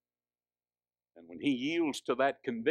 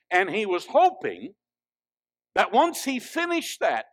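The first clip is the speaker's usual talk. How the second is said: raised, louder pulpit delivery; pressing sermon voice, pitched up; forceful preaching; 7 semitones higher, 7 dB louder than usual. Real, not acted. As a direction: preach it out high and strong.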